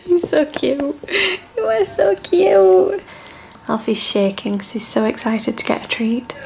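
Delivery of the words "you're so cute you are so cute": high, cooing pet voice. Real, not acted. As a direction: coo adoringly at a cute little dog. Cooing over her dogs.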